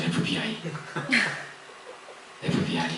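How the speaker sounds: 에프비아이 whispered under the breath, as a hidden swear. Shown as a demonstration. (에프비아이를 속삭이듯)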